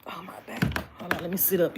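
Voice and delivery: whispered groan from a sore back; back pain. Real, not acted. Reader shifting in her seat, whispered pain at her back. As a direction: breathe it out as your back twinges. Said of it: "'cause my back be hurting".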